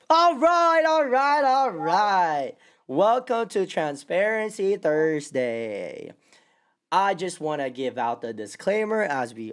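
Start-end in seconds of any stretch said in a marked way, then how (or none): 0.1-2.5 high, loud hype opener, fading down; excited. Real, not acted. Show intro hype. 2.9-6.1 slow, emphatic show welcome; host announcement. Acted.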